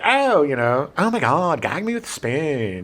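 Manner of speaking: put-on Valley Speak; Valley girl impression. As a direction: mimic an eighties Valley girl. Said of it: "But she's like".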